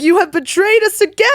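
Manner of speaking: mock furious druids. Betrayed druids turn on party.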